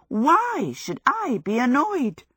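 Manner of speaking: slow, high, swooping mock innocence; sarcastic indignation. A narrator's reading. Sophie denying to Howl she is annoyed, sarcastic.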